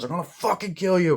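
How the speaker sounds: imitated angry outburst; acted bully threat. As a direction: order them silent, high and heated. Acting out bullies' threats.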